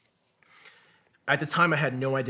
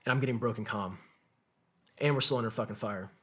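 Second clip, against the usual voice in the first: usual speaking voice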